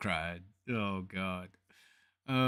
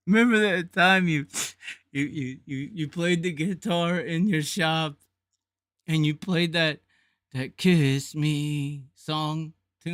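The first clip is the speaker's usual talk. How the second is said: fake crying plea; 6 semitones higher, 8 dB louder, slower than usual. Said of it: "Did it look like I was crying?".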